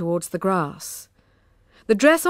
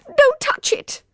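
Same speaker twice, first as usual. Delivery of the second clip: high, loud sharp cry of pain; 6 dB louder, slower than usual. A narrator's reading. Emma crying out sharply at Poppy, high and loud. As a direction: cry out to stop her. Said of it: "Emma cried out sharply".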